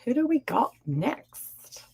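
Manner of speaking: slow breathy whisper, teasing. Pretend. Host whispering to her live chat, playful. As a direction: lean in and whisper the question.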